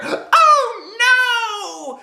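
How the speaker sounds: very high loud cry of dismay; shrieking little girl; hollering. Acted. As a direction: wail like a girl whose dress is ruined. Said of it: "she hollered".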